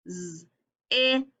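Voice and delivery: "Z-i-p" in three separate sounds; sounding it out. Shown as a demonstration. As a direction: buzz the z, hold the short i, pop the p. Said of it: "stretching the word out".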